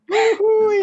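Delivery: whooping high and loud with a laugh; excited cheer. Real, not acted. Host cheering the sponsor news, loud whooping. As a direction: cheer it out like a celebration.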